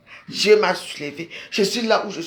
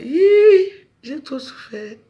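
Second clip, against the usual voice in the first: sob; 6 semitones higher, 3 dB louder, much slower than usual. Real, not acted. Telling her visitor how much she suffered, sobbing. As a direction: let the pain break through.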